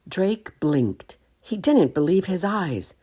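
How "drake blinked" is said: ordinary delivery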